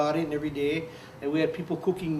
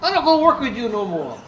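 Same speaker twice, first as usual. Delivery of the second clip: higher, louder angry refusal; retold anger; 7 semitones higher, 7 dB louder than usual. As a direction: refuse him flat out, heated. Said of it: "I got really pissed off".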